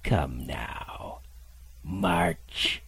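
cruel cold order. Acted. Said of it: "a voice so cruel and cold and ugly".

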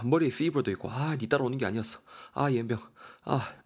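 sighing, high-pitched whining; exhausted, grumbling hiker. Acted. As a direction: moan and curse your way up the mountain. Acts out exhausted complaining hiker.

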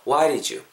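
"why did you" as "why-ri-joo"; "why did you" with a quick tapped D; D softened to R. Shown as a demonstration. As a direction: flap the first D of did like a Korean ㄹ in "why did you". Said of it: "리을로 바뀌면서".

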